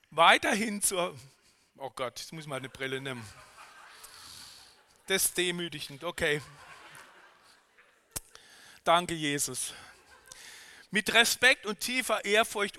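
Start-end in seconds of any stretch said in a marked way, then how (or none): none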